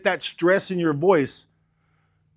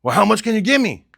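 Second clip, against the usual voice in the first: higher, louder customer voice asking the price; 5 semitones higher, 4 dB louder than usual. Retold or imitated. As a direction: play the eager seller on the phone. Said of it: "he's gonna say".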